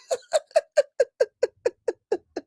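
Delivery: high, breaking laughter; real laughing fit; long cackling laugh. Real, not acted. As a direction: cackle helplessly at your own joke. Laughs at her own joke.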